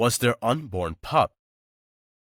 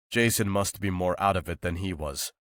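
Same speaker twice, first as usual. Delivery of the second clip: usual speaking voice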